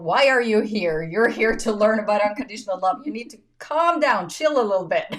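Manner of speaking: loud self-reminder. Retold or imitated. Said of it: "reminding myself of".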